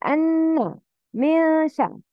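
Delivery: أن with a long nasal "nn"; ghunnah. Shown as a demonstration. Teacher isolating the rule, nasal hold in أن. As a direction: hum the "n" in أن and من شر. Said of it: "Nasal sound".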